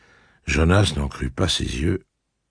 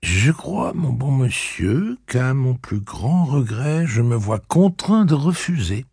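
jeune Cuthbert narquois; 10 semitones higher, slower than usual. Acted. Cuthbert refuse poliment.

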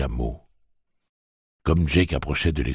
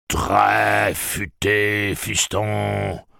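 slow, drawn-out, higher-pitched mock praise; mocking villain; 6 semitones higher, 4 dB louder, much slower than usual. A narrator's reading. Pirate mocking his opponent's cleverness, drawn-out sneer.

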